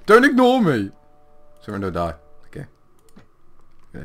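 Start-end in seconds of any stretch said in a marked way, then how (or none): 0.0-0.9 rising, louder, desperate call; needy plea building to yell. Acted. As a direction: beg him to come back, then shout. Begging enemy, building.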